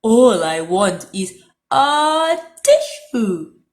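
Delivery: slow, loud snuffly plea; stuffy wolf. A narrator's reading. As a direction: drag it out through a blocked nose. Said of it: "sniffed Big Bad".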